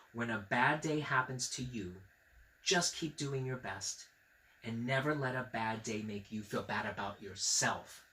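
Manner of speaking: soft, sincere and gentle; tender advice. Real, not acted. Reader passing the mommy's advice to the viewer, softly. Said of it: "I want to say that to you".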